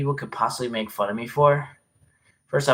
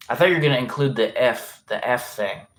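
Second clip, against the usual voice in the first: ordinary talk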